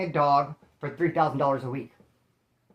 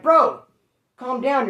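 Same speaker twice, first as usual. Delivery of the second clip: higher and louder, urgent; yelling calm down; 6 semitones higher, 7 dB louder, slower than usual. Retold or imitated. To his wild friend mid-fight, urgent loud yell. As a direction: shout him down before he swings.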